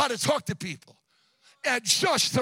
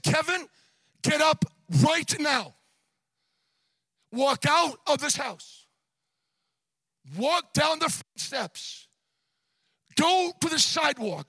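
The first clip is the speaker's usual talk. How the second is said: higher, commanding divine voice in measured phrases; voice of God; 3 semitones higher than usual. Retold or imitated. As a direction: deliver God's orders step by step, with long pauses. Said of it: "And the voice said".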